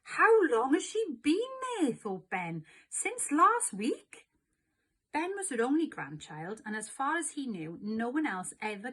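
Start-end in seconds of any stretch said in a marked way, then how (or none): 0.0-4.3 incredulous. A narrator's reading. Ben's incredulous inner thought.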